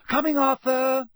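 loud, high call to another room. A narrator's reading. Wife calling to husband downstairs, loud. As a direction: call out to the kitchen. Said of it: "called Missus Weasley".

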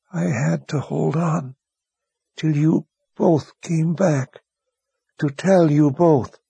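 high, slow, faint voice of a dying man; a wounded man using his last strength to speak; dying young wizard. A narrator's reading.

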